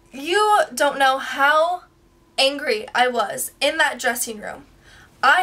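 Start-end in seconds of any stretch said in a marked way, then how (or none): none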